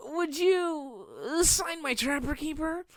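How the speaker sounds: high, slow, breathy-free fan voice; mock swooning fan. Fan asking a crush for an autograph, mock gushing. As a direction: gush at the celebrity.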